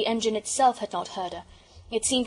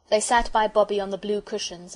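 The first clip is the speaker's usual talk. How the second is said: plain reading voice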